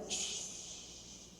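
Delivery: breathy unvoiced shh; Jesus calming the sea with a whispered shh; whispered shush. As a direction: hush the storm with a soft shush. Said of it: "que le diga".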